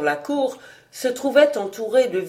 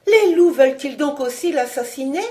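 raised, higher-pitched alarmed question; terrified; 6 semitones higher, 5 dB louder than usual. A narrator's reading. Young lady fearing the wolves will kill him, terrified. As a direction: burst out in dread, rising to the end. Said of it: "avec terreur".

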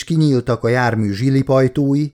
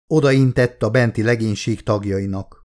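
ordinary delivery, nothing marked

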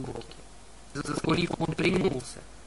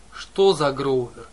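loud, slow suspicious growl; menacing; 2 semitones lower, 6 dB louder, slower than usual. Acted. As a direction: drag it out with menace. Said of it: "злобно покосился на неё Полифем".

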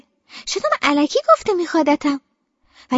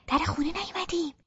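quietly; 2 semitones higher, 12 dB quieter than usual. A narrator's reading.

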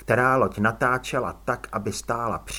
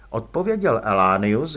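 ordinary delivery, nothing marked

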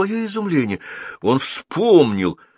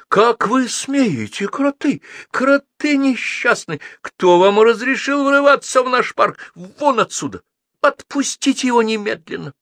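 put-on high, indignant shouting girl; furious scolding; 13 semitones higher, 3 dB louder than usual. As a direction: lash out at them in outrage.

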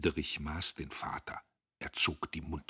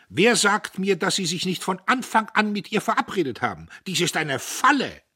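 much higher and louder outrage; indignant; 14 semitones higher, 12 dB louder than usual. Acted. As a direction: hurl the accusation. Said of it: "Mit Entrüstung". (mit lauter Entrüstung)

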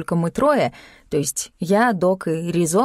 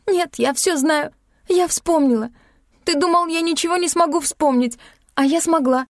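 high-pitched, overwrought voice; agitated insistence; 9 semitones higher than usual. A narrator's reading. Heroine tells Klim she has remembered, agitated. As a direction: insist shrilly, close to hysteria. Hysterical accusation over the phone.

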